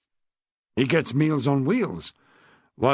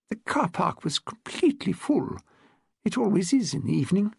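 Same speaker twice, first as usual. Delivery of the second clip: put-on higher, refined elderly upper-class voice; posh old lady; 6 semitones higher than usual. Old lady tells Rose about her husband, posh elderly voice. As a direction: speak like a frail, well-bred widow of seventy.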